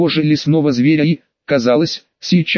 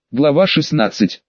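ordinary delivery

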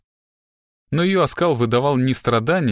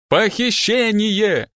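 drawn-out docker's holler, pitch raised; coarse shout; 9 semitones higher, much slower than usual. A narrator's reading. Captive girl accuses her captor of kidnapping, yelling coarsely. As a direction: yell it slowly like a rough docker. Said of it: "Грубым, как у портового грузчика, голосом закричала она".

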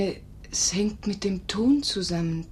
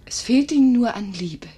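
earnest; 4 semitones higher, 6 dB louder than usual. Acted. Nurse declaring earnestly.

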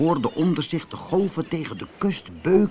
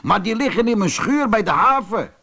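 higher, projected explanation; raised storybook calling voice; called out; 8 semitones higher than usual. A narrator's reading. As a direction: keep calling it out to the rider. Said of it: "riep Ben".

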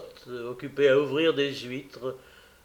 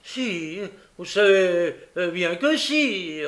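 raised, whining insistence; insistent protest; 5 semitones higher, 5 dB louder, slower than usual. Acted. Noé contradicting Charlotte before Bumble, insistent. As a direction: insist on it, pitched up.

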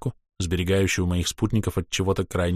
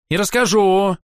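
louder, higher, stamping-foot retort; petulant child; 13 semitones higher, 7 dB louder than usual. A narrator's reading. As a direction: snap back like a spoiled child. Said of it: "топнул ногой ... капризного ребёнка".

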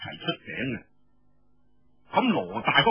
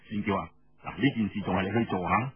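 conspiring man; 5 semitones lower than usual. Acted. Feng urges Xuanjiao.

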